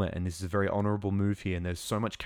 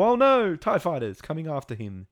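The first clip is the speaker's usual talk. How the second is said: much higher, louder mock alarm; alarmed gasp; 8 semitones higher, 5 dB louder than usual. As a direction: cry out as if the ship is in danger. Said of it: "We go".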